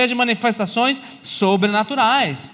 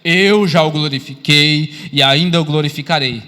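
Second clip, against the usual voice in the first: loud, slow, lower-pitched proclamation; God's voice from heaven; 5 semitones lower, 5 dB louder than usual. Acted. God answers Jesus from heaven, loud solemn proclamation. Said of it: "Deus falando agora do céu".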